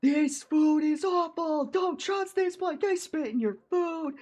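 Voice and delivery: high-pitched, slowed strained mock scream; drawn-out falsetto yell, hammed up. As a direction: imitate a man screaming warnings across a lot. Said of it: "just starts screaming".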